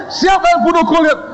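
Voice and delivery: very high, theatrical demanding yell; comic high-pitched outburst; mock angry interrogation. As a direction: demand it like an exploding boss, hammed up. Boss repeats accusation.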